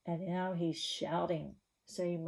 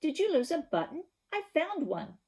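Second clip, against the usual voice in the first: high, chirpy little bird voice; a small bird asking politely; sparrow voice; 9 semitones higher, 4 dB louder than usual. Acted.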